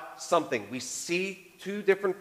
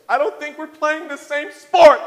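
high, loud, slow hammed-up exasperation; dramatic wife; 12 semitones higher, 10 dB louder than usual. Wife exaggerating how far apart the couple feels, hammed-up. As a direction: overplay the wife's despair. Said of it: "we get really dramatic".

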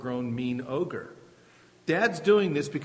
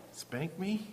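put-on timid child's answer; 11 dB quieter than usual.

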